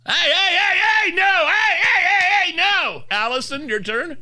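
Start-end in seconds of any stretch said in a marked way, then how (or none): 0.1-3.0 much higher, louder hey hey no; full mom yell at a moose; mom voice shout. Shown as a demonstration. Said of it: "let's hear everybody the mom voice".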